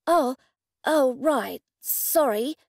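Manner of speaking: halting, flustered apology; embarrassed stammer. A narrator's reading. Sybil apologizes after being cut off, embarrassed stammer. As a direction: falter slightly, embarrassed, with pauses between words. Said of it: "I stammered as I felt my face burn".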